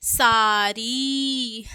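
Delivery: सारी slowly, "saaa-reee"; drawn-out final ee in सारी; long ee. Shown as a demonstration. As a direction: stretch both vowels of सारी. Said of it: "बड़ी की मात्रा लगी है".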